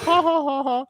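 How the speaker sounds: very high imitated old-lady laugh; high, put-on "ha ha ha". Old lady laughs at narrator's joke, high mock laugh. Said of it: "And she's like".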